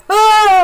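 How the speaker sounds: loud, very high startled yelp; a hammed-up cry of a man flung back; high loud mock yelp. Reviewer imitating the vicar thrown into a chair, loud high yelp. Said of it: "and the guy goes".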